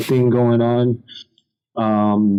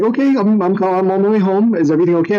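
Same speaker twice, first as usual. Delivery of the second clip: mock-cheerful, higher-pitched girlfriend voice; all happy; 7 semitones higher, faster than usual. Girlfriend on the phone heading home, all happy. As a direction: play her happy and unsuspecting, pitched up. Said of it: "she was, like, all happy".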